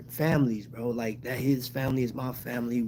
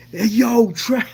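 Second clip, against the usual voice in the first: much higher, louder excited shout; hyped greeting; 11 semitones higher, 9 dB louder, slower than usual. Retold or imitated. Friend answering the phone, hyped loud greeting. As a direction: burst in hyped like a friend calling. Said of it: "He was so hyped".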